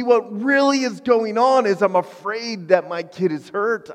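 ordinary talk

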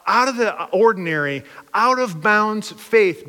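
ordinary talk

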